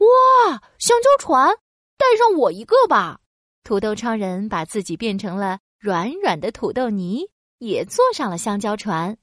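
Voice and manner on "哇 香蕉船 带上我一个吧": much higher, loud eager plea; excited. Acted. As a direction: beg to come along, thrilled. Potato begs excitedly.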